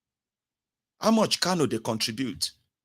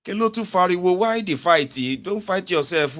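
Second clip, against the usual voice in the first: ordinary talk, nothing marked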